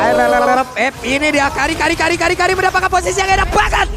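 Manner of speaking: high, rising hype; excited high call. Real, not acted. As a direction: cheer the play on, pitch up. Caster hypes a pick-off. (seruan caster yang bersemangat)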